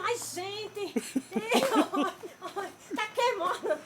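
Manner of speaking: real laughter through the words; laughing. Real, not acted. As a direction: laugh helplessly as it burns. Laughing while corn burns.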